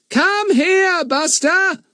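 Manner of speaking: high yell at the top of voice. Acted. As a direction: shout for the dog as loud as possible. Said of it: "yelled at the top of their voices".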